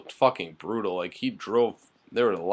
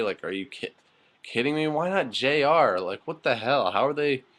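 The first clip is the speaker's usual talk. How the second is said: exasperated rant; 5 semitones higher than usual. Real, not acted. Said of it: "I have no energy to rant".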